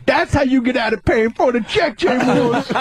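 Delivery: high, proud street voice; high and pleased with himself; Tracy impression. As Tracy after being thrown out, high and proud. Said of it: "and he goes".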